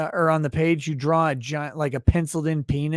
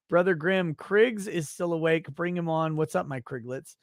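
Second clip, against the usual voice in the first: normal talking voice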